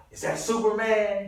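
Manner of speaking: lifted, drawn-out delivery line; movie line. Retold or imitated. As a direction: read it like a line on set. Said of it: "They go".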